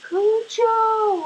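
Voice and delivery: loud, high shout; dramatic shout. Acted. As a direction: call out the name. Dramatic exclamation about the dog.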